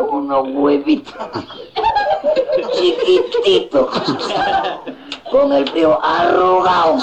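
very high, stretched-out little squeak; tiny mocking voice. Comic mocking today's shrunken Peruvians, high tiny voice. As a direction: draw out the smallness in a high voice.

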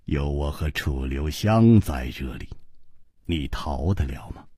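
a cold, steady companion pressing him; cool 季冰燕 voice. Acted. 季冰燕 held low voice. (低沉冷静，不容推辞)

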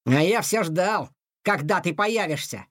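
ordinary delivery, nothing marked

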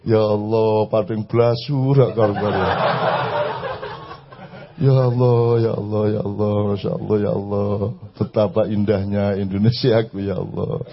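low, sighing pious voice, hamming up the awe; mock pious sigher.

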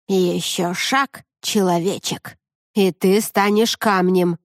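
slow, threatening raven voice; menacing raven. A narrator's reading. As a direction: warn slowly like a dark raven.

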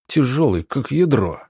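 ordinary delivery

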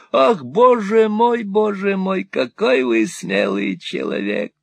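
put-on high, wheedling old miser voice; fawning old moneylender. Old usurer fawning on Ralph, high and wheedling. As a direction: simper it like a cringing usurer. Gride voiced in Nickleby reading.

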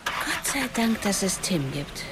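motherly voice. A narrator's reading. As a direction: greet them as a fond mother. Kyles Mom spricht. (leicht erhöhte Mutterstimme)

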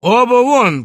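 loud, high furious command; continued shouting. A narrator's reading. Doctor ordering both guests out, furious shouting. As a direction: bellow them out of the room. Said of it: "продолжал кричать Андрей Ефимович".

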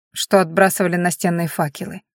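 usual speaking voice

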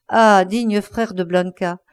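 plain reading voice

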